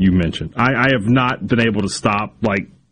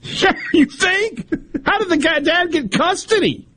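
very high, squealing incredulous laughter; laughing. Real, not acted. Cohost reacting to messages about the wife, squealing with laughter. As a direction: squeak it out through helpless laughter.

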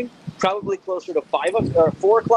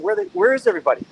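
surprised; 8 semitones higher, much faster than usual. Retold or imitated. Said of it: "I looked around and said".

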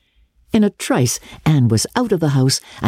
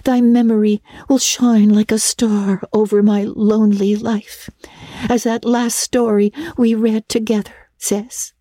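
put-on higher girlish voice, theatrically solemn; solemn, affecting; 7 semitones higher than usual. A narrator's reading. Anne asks parting Diana for a lock of hair, solemn. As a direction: recite it like a romantic young heroine. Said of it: "Anne's affecting accents".

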